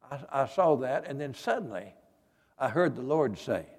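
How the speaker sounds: ordinary talk, nothing marked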